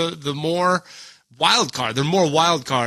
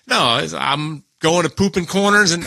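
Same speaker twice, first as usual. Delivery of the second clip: usual speaking voice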